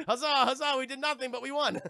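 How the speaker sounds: loud, high, laughing huzzah; mock victory cheer. Streamer celebrating with chat, laughing mock cheer. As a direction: cheer in hammed-up triumph.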